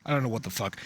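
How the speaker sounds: ordinary delivery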